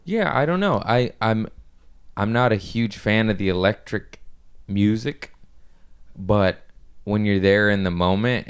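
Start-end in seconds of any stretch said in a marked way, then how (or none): none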